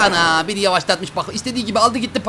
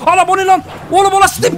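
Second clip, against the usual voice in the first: startled shout; 10 semitones higher, 6 dB louder than usual. Real, not acted. Monster suddenly appears in game.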